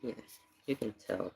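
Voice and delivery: ordinary talk, nothing marked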